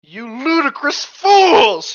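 loud, high, slow outraged cry; drawn-out furious shout, acted; theatrical shout. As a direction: bellow it like a hammy villain. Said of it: "he shouted".

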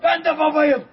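loud, high father's wail; anguished cry. Real, not acted. Father crying out about his children.

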